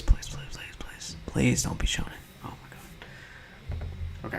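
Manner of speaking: rapid hushed begging; whispered pleading. Real, not acted. Begging not to be shonen, whispered plea. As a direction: whisper it like a nervous prayer. Anxious pleading before quiz result.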